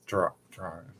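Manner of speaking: low, hushed, hesitant Aussie attempt; Australian accent. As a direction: test an Australian accent under your breath. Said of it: "How do you say dragon in an Australian accent?".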